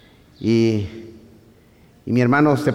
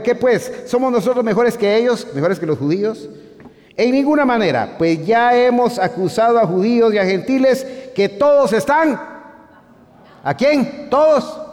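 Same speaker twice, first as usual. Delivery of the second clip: fiery preaching; 10 semitones higher than usual. Acted. Preacher reading Romans to the church, fervent. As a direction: preach it out to the congregation.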